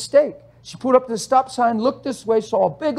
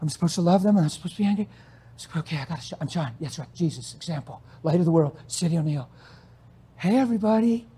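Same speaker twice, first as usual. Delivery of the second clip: hushed, anxious self-talk trying to stay okay; mock nervous believer talking himself down; a hint of strained calm; forced composure; 4 semitones lower, 7 dB quieter, faster than usual. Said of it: "you're gonna go".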